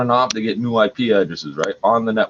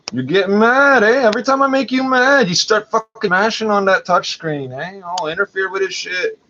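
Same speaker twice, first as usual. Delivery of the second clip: high mocking taunt; 8 semitones higher than usual. Real, not acted. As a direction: needle them, pitch climbing up. Taunting unseen watchers, rising.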